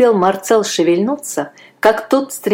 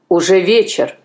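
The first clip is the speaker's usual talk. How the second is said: plain reading voice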